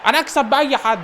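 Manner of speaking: shouted high and loud, full of swagger; loud bragging outburst; boastful shout. Real, not acted. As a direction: yell it out like a cocky winner.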